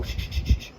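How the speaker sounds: quick unvoiced shushes; short hunter's shh; shushing. Shown as a demonstration. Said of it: "So I go".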